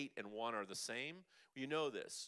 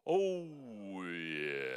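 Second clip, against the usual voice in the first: exhaled vocal release; 3 semitones lower, 8 dB louder than usual. Shown as a demonstration. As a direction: exhale "Oh, yeah" in one falling sweep.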